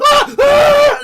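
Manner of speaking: loud high scream. Acted. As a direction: scream as you burst into flames. Man catches fire, screams.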